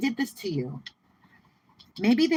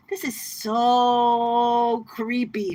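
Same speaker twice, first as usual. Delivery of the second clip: creeped out; 4 semitones higher, 4 dB louder, much slower than usual. Real, not acted. Reacting to an unsettling card.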